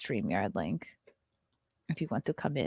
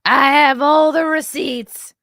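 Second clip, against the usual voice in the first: loud, slow, hammed-up TV prosecutor; cheap Nancy Grace voice; 6 semitones higher, 8 dB louder, slower than usual. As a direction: drag out every word like a smug accuser. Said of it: "my dollar store Nancy Grace voice".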